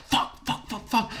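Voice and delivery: whispered, rapid-fire mock panic; frantic whispered swearing, retold; hushed panic. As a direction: imitate panicked cursing under the breath. Retold group panic while hiding.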